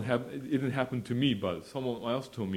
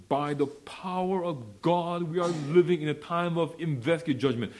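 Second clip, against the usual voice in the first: preaching at full force; 7 semitones higher than usual. Real, not acted. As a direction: lift it up like a pulpit cry. Preacher declaring with power.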